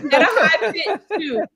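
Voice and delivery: teasing through laughter; laughing. Real, not acted.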